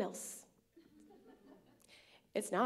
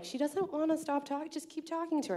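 friend urging; 8 semitones higher than usual. Retold or imitated. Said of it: "And Rob was like".

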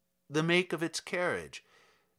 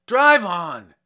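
loud frantic shout; wild cry; 2 semitones higher, 12 dB louder than usual. Acted. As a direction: shout it wildly, half panicked. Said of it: "cried wildly".

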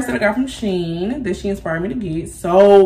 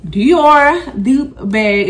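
drawn-out showy announcement; 5 semitones higher, 5 dB louder, much slower than usual. Acted. Presenting bag.

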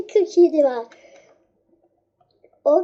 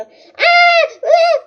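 much higher and louder delighted cries; excited squeal; 10 semitones higher, 4 dB louder than usual. Real, not acted. Player finds food and squeals with glee.

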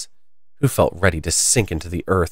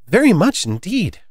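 eager; 10 semitones higher than usual. A narrator's reading. Alice answering eagerly.

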